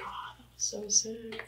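high but hushed squeal; mock excited scream. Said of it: "like, screaming".

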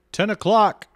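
a high bellow through the door; guard roaring the curfew at the door; roared. A narrator's reading. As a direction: roar it to make everyone jump. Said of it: "roared Jackson".

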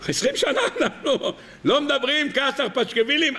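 high, laughing disbelief; astonished laughing disbelief. Acted. Bar Kamtza laughing in disbelief at being invited.

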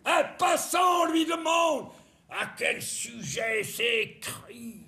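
a hammed-up Michel Bouquet rising to a wail. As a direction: swell from calm to grand theatrical anguish. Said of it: "on peut la dire à la Michel Bouquet".

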